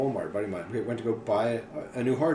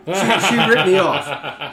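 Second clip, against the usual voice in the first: much higher, louder, slow, laughing whine; indignant woman; 7 semitones higher, 11 dB louder, much slower than usual. Retold or imitated. Woman complaining to cop about fake drugs, laughing whine. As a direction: whine it like a cheated buyer, laughing.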